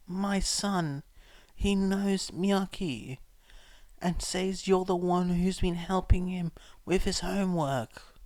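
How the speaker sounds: scarred woman. A narrator's reading.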